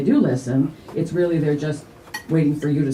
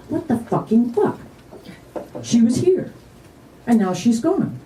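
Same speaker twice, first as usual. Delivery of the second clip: high-pitched, disbelieving outburst; bewildered inner voice; 7 semitones higher than usual. Acted. Mourner's inner noise like tinnitus, bewildered.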